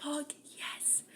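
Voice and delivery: voiceless, gleeful fuck yes; a hushed, thrilled whisper; whispered triumph. Retold or imitated. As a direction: hiss it in secret delight. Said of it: "I'm just like".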